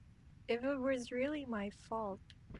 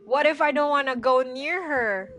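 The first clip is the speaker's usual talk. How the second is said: louder, higher frightened protest; loud nervous whine; scared protest; 4 semitones higher, 14 dB louder than usual. Real, not acted. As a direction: plead not to go near. Scared of approaching ghost.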